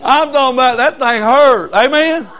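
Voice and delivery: high, loud preacher's cry on hurt; preaching shout. Acted. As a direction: drive it home to the congregation. Preacher hits a point, crowd responds.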